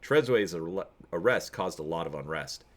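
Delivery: ordinary delivery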